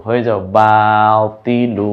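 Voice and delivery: বাতিলু slow with the last লু stretched; drawn-out batilu. Shown as a demonstration. Teacher to students, stretching বাতিলু slowly. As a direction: lean into the final লু of বাতিলু. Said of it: "লু বানাতে বলছে তো".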